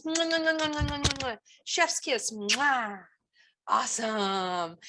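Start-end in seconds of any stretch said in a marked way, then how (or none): 0.0-3.0 between kisses. Real, not acted. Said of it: "Kiss your brains".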